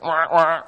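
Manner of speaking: mock duck call, a quick nasal wah wah; duck call quack. Imitating the family's duck calls for the congregation, playful mock duck call.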